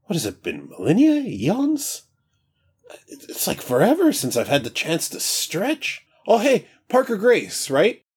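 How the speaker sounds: high, breezy, carefree god; casual new voice. Acted. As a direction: stretch and chat like just woken. Newly awakened god chats.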